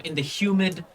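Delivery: ordinary talk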